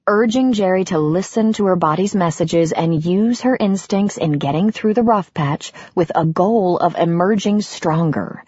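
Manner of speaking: ordinary delivery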